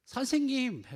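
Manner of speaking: high, loud cry to a teacher; acted pleading shout over a crowd; desperate call. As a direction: call out urgently, pitched right up. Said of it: "간절한 거죠".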